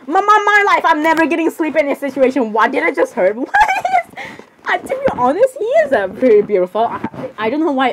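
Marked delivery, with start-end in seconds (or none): 3.5-4.4 high squealing giggle. Acted. Giggling at Jeff.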